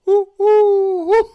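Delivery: high, loud owl-like woo calls; stand-up bit doing a loud high bird call; pigeon call imitation. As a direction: hoot like a bird outside the window. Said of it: "Outside your house".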